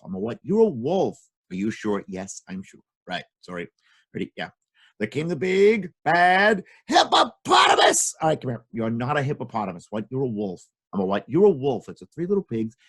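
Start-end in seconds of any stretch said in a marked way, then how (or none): 5.0-8.1 slow shouted reveal, much higher; dramatic announcement. Acted. Storyteller announcing the villain with a big shout. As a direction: hit the animal name hard. Third dramatic entrance try.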